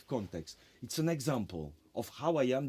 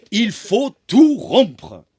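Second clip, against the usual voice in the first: put-on French with a heavy German accent, much louder, proclaimed; Lachenmann's German-accented French; 8 semitones higher, 17 dB louder, much slower than usual. A teacher proclaiming to his seminar, in German-accented French, loud. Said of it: "speaking in French with a German accent".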